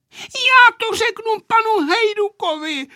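put-on very high, loud little girl's yell; crying out. A narrator's reading. Aneška threatening to tell the pilot, yelling. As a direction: shriek it in a piercing child's voice. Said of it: "vykřikla Aneška".

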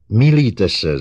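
loud, high protest; exclaimed. A narrator's reading. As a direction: cry out to contradict her. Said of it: "zvolal Herbie".

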